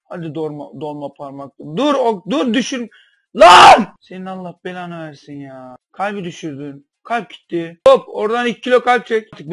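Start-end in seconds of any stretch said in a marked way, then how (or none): 1.6-2.9 loud, high warning; panicked shout. Real, not acted. Kalp düşerken telaş. 3.4-4.0 very loud, high yell; furious shout. Real, not acted. Kalp düşünce bağırıyor. 7.9-9.3 loud, high teasing shout; loud mocking call. Real, not acted. Kalp düştü, alayla bağırıyor.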